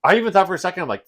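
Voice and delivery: ordinary talk, nothing marked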